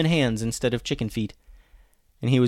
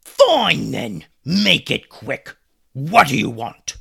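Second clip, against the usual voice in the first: annoyed captain; 4 semitones higher, 8 dB louder, slower than usual. Acted. Angry pirate captain answers.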